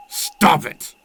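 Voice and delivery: loud, high snapping shout; sharp shout. Acted. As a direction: cut them off hard. Vampire cuts off the listener's pleas.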